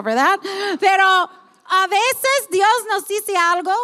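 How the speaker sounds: ordinary talk, nothing marked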